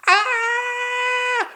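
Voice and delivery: very high startled shriek; shrill scream. Acted. The Queen shrieking at the face in the mirror. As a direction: scream in fright.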